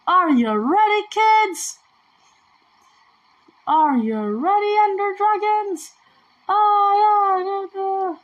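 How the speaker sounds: put-on shouting to the kids; excited call-out. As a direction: call it out like a cartoon captain. Hyping up like a show host.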